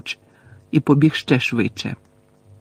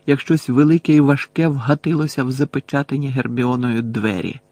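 plain reading voice

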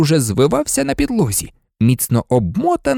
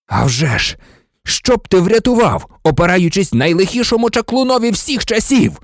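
high, angry, mocking question; furious retort; 6 semitones higher than usual. A narrator's reading. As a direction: throw the excuse back in fury. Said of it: "розлючено перепитав Блек". (розлючене перепитування високим голосом)

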